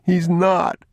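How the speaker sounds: put-on high-pitched childish denial; tearful protest. Crying boy insists the thief isn't gone, tearful.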